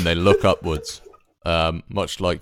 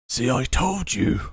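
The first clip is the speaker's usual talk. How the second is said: second wolf voice; 8 semitones higher than usual. Acted. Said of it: "the other one says".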